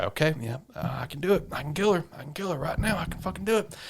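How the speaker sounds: hushed, rising self pep talk; psyching himself up. Retold or imitated. Shinpei gripping the knife, hushed rising self pep talk. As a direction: talk yourself into it under your breath. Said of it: "So he's like".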